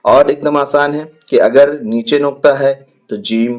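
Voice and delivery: usual speaking voice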